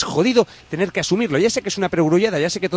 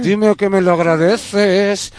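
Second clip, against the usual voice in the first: drawn-out, hammed-up silky plea, a bit louder; mock silky voice; 3 semitones higher, 4 dB louder, much slower than usual. Shown as a demonstration. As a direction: purr it very slowly, playing up the seduction. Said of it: "dímelo con la voz más sedosa".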